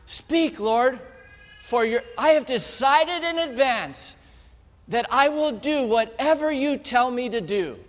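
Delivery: high, loud, earnest servant's reply; a boy eagerly pledging to obey God, eager and earnest; eager devotion. Acted. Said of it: "Samuel goes like this".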